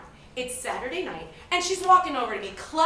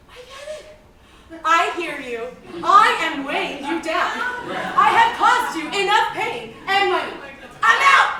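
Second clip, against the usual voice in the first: the hair quitting, building loud; 5 semitones higher, 9 dB louder than usual. Acted. Hair telling its owner it is leaving, building to loud. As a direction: let the hair storm off fed up.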